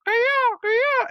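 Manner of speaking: very high piercing peacock cry; peacock call. Retold or imitated. Neighbor's peacock at roosting time, high mimicked cry. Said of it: "that thing would go".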